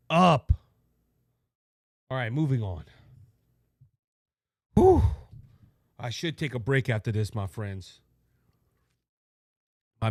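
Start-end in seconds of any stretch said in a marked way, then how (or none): 4.7-5.0 short blown-out sigh of relief; exhausted whew. Real, not acted. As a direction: puff out after a rant. Catching breath after rant.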